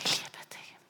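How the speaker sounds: gentle divine comfort, mostly whispered; voice of God. Retold or imitated. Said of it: "höre ich Gott, der sagt".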